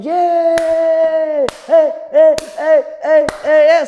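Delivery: loud, high hyped-up hollering; rowdy prison yard cheer; crowd cheering. Retold or imitated. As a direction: holler like inmates egging on a fight. Inmates cheering at the commotion.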